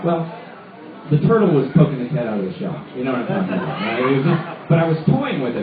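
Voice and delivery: usual speaking voice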